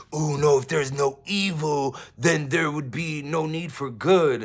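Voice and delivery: mocking their voice. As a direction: ham up the opponents' preachy reply. Voices the others' objection.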